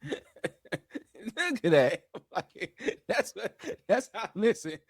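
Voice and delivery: talking through laughter; words broken up by laughs; laughing. Real, not acted. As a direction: crack up while getting the words out. Cracking up at his own bit.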